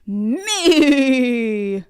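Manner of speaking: loud booming goat shout; biggest voice yell. A narrator's reading. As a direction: shout back at the troll. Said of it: "yelled ... in his biggest voice".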